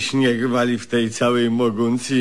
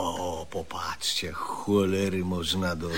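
very soft, low gossiping whisper; 6 semitones lower, 12 dB quieter than usual. Retold or imitated. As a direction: murmur it like passing gossip. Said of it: "te szepty".

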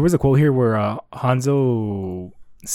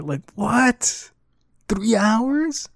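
surprised; 10 semitones higher, much slower than usual. Real, not acted. Mocking the abrupt time skip.

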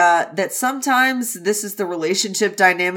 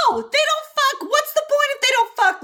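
much higher, mock-scandalised protest; mock outrage. Real, not acted. Complaining to co-hosts the book has no sex, high mock outrage. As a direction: squeal it like it's a scandal.

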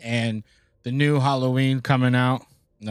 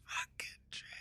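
whispering; 23 dB quieter, faster than usual. Real, not acted. Realizes April Fools trailer.

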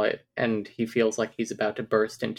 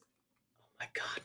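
a breathless, shocked whisper; stunned whisper; 16 dB quieter, slower than usual. Real, not acted. To her cohost after finding a second epilogue, a stunned whisper. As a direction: whisper it like you can't believe it.

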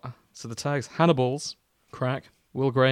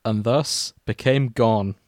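mock-solemn fanfic reading, mostly at usual pitch; story narration; 6 dB louder than usual. Podcast host reading a crack fic to cohosts, mock-solemn narration. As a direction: read the opening like a grave eulogy, tongue in cheek.

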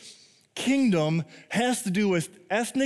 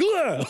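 high-pitched mock fright noise; comic jolt of surprise; startled yelp; 10 semitones higher, 4 dB louder than usual. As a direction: yelp as if caught off guard. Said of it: "When I get scared".

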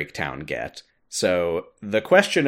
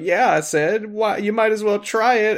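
high-pitched goading voice; teasing master; 12 semitones higher than usual. Retold or imitated. Master joins the joke on Aced, high and teasing. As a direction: egg him on in a put-on, lilting high voice.

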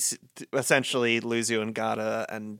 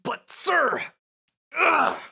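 high, louder cry of frustration; frustrated protest; 4 dB louder, much slower than usual. Acted. Dismissed cadet protesting his commander, high frustrated cry. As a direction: throw up your hands at the order.

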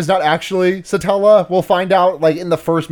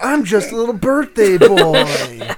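mock childish voice; 3 semitones higher than usual. Riffing on the Puck voice.

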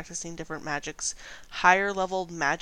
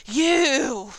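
shouted accusation; 7 semitones higher, 10 dB louder than usual. Acted. Gnome points at the party.

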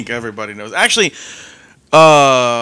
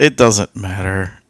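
ordinary talk, nothing marked

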